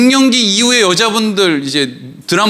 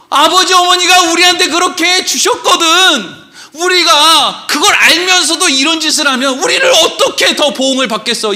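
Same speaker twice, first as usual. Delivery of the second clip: higher, insistent appeal to a younger brother; acted big-brother reproach, pitched up; older brother pleading; 9 semitones higher than usual. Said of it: "형이. 방황하는 동생한테".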